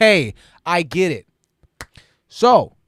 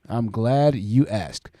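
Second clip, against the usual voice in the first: very slow, lower repeat; slow drawn-out; 8 semitones lower, 4 dB quieter, much slower than usual. Real, not acted. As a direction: stretch it out slowly, savoring it.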